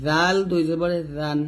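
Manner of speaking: জাল and জান with a thin voiced th, tongue at upper teeth; light "dh" opening on জান; thin dhal. Shown as a demonstration. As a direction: keep জাল thin and lisped. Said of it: "তবে জাল পাতলা হবে".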